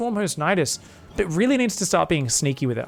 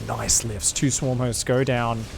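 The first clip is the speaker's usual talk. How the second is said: normal talking voice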